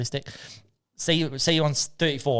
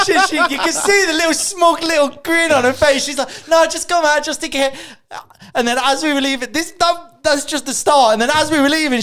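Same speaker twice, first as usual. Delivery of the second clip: loud, very high comic rant; worked-up outrage; 6 dB louder than usual. Real, not acted. Telling friends about partner's smug grin, squeaky outrage. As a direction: rant at the top of your range, near laughter.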